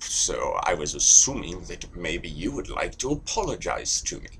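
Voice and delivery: put-on measured British accent, laying out a grievance. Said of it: "But you're British".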